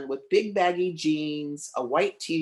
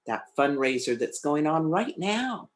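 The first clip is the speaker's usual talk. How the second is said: guided meditation; 3 semitones lower than usual. Acted.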